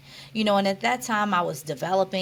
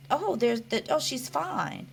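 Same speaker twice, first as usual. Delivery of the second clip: higher-pitched, offhand mock onlooker; imitated casual dismissal; 7 semitones higher than usual. Onlooker judging a brain-injury survivor, lightly dismissive. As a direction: voice someone brushing off the worry. Voicing onlookers' mistaken assumption.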